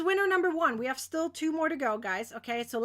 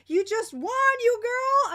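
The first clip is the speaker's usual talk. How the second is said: high, loud cheer to a winner; excited congratulations; 7 semitones higher, 6 dB louder, slower than usual. Real, not acted. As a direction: celebrate her win out loud.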